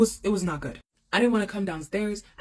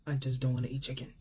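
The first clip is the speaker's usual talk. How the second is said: low, subdued near-tearful retelling; quiet, understated upset; holding back tears; 5 semitones lower, 5 dB quieter than usual. Retold or imitated. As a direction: say it softly, as if trying not to cry. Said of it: "trying desperately not to cry".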